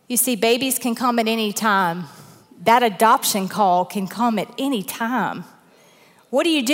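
usual speaking voice

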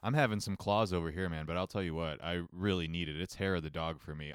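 ordinary talk

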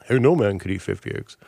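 low-pitched film convict impression; George Kennedy. Convict doubting an egg-eating bet, low impression. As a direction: drop the voice low like a chain-gang prisoner. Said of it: "George Kennedy talked like that".

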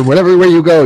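almost yelling the obvious; exasperated shout. Real, not acted. Exasperated point about directions.

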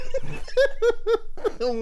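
high helpless laughing fit; can't stop laughing, shocked; horrified amusement. Real, not acted. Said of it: "Why is this so funny?".

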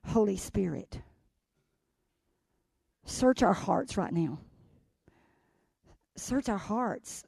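soft, slow prayerful voice; hushed prayer. Acted. Pastor opening in quiet prayer, soft and slow. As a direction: pray quietly over the room.

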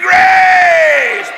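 high, loud Grace held longer; repeated shout. Shown as a demonstration. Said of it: "Say it again".

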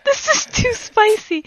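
high giggly voice; laughing. Real, not acted. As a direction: squeak it out laughing.

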